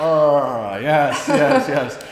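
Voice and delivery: lightly voiced gleeful yes yes yes; a hint of a grinning Wolf of Wall Street; Wolf of Wall Street meme. Cohost mimicking the meme at his desk, light glee. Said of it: "Wolf of Wall Street meme".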